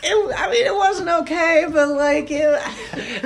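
high-pitched words breaking into laughter; laughing. Real, not acted. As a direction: laugh through it, can barely get it out. Laughing about prom story.

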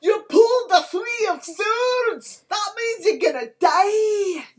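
screamed tarot reading. Pretend. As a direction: scream the fortune at them like doom is coming. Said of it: "I will scream bad tarot readings at you".

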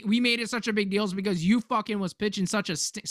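ordinary talk, nothing marked

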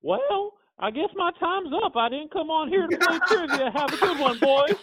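very high, wailing impression voice; Paul Bearer. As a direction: sign off in a high-pitched put-on voice. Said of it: "And he was like".

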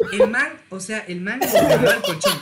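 loud laughing telling of the punchline; laughing loudly. Real, not acted. Telling friends the mattress story, laughing loudly.

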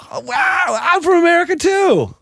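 high, shouted delight; excited American. Retold or imitated. American meeting another American abroad, shouting with joy. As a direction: squeal it like finding a long-lost friend. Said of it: "you're like".

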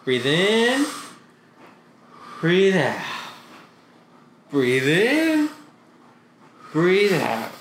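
drawn-out cues with long pauses; guided breathing. Real, not acted. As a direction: coach calm breaths, rising on "in", falling on "out". Leads a friend through deep breaths.

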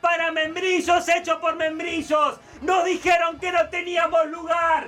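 hammed-up political victory shout; mock campaign speech. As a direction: rouse the crowd like a winning candidate.

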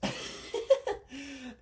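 high, soft burst of laughter; high-pitched quiet cackle. Real, not acted. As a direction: crack up helplessly. Cracks up at the exchange.

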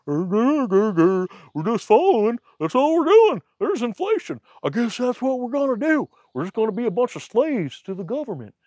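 high put-on voice mocking the herd; mocking sheeple. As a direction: play a mindless sheep grumbling along. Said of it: "sheeple out there just like".

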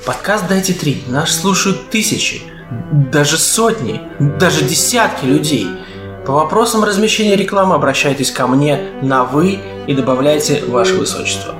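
ordinary talk

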